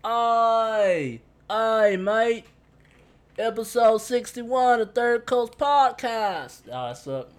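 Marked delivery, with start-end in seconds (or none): 0.0-2.4 loud, high mock-Australian holler; bad Australian accent. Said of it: "My Australian accent suck". 3.4-6.6 high, loud, hammed-up Australian announcement; bad Australian accent. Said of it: "My Australian accent suck".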